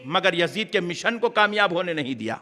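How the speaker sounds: normal talking voice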